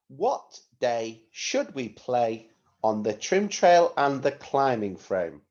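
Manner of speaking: slow, deliberate phrases to be repeated; measured teacher dictation, phrase by phrase; slow model reading. Shown as a demonstration. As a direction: say it for pupils to echo. Teacher modelling sentence for repetition.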